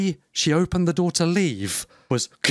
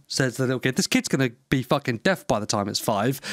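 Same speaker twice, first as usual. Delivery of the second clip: ordinary talk, nothing marked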